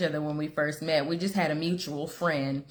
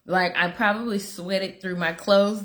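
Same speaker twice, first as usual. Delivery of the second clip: ordinary talk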